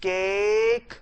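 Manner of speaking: delay केक and let it hang; delayed stress. Shown as a demonstration. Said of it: "बस उसको delay करना है". (केक को देर तक खींचें)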